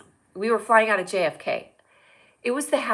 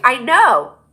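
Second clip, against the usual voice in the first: loud, raised re-enacted outburst; emphatic retold reply; 4 semitones higher, 11 dB louder than usual. Flight attendant answering Larry David about glasses, emphatic outburst. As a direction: burst out with it, quoting yourself.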